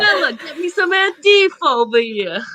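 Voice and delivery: a hint of a hammy Italian waiter; mock Italian. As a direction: call out like a pretend Italian chef. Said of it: "You did Dracula that whole time".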